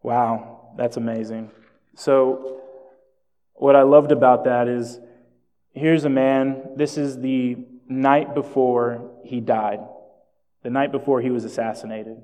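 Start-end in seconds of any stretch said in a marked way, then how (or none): none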